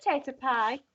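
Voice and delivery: imitating a child's Wigan accent. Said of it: "got a, quite a Wigan accent".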